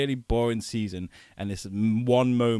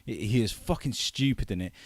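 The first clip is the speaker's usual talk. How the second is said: usual speaking voice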